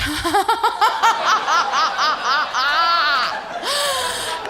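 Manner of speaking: high staccato laugh with a long tail; exaggerated fake laugh. Stoned driver laughs because drivers do, exaggerated fake laugh. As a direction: force out a manic, hammed-up cackle. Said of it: "They laugh".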